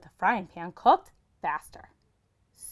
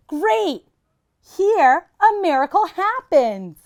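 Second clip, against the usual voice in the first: higher and louder, triumphant; excited call; 9 semitones higher, 8 dB louder, slower than usual. Acted. Herschel tries the magic words, excited. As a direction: crow it out proudly.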